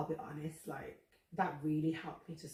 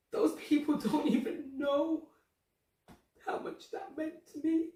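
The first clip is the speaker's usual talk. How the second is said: higher, a little louder, choking up; getting emotional; 9 semitones higher, 6 dB louder than usual. Real, not acted. Thanking strangers who encouraged her, choked up. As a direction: let the voice catch on the memory. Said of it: "I'm getting emotional thinking about it".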